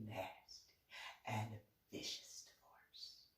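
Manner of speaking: breathy whispered threat; venomous whisper. Real, not acted. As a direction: hiss it out like a curse. Cursing the flower thief.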